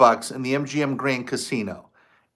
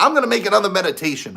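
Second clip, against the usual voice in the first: eager resolve; 7 semitones higher, 3 dB louder, much faster than usual. Retold or imitated. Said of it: "I'm like".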